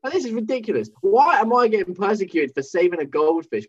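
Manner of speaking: high, mock-outraged protest; defiant teen. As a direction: play your younger self refusing the punishment. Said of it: "And I was like".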